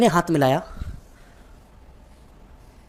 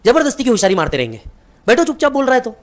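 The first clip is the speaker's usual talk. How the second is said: higher, louder scolding wife; wife scolding her husband, higher and louder; mom scolding; 7 semitones higher, 5 dB louder than usual. Retold or imitated. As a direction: tell him off to just sit down. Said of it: "mummy ने पापा को डांटा".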